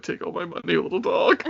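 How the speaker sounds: put-on high, sing-song baby talk to a little dog; mock gushing at a sweet little dog; cutesy dog talk. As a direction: coo at the puppy as if it could hear you. Gushing over a cute dog.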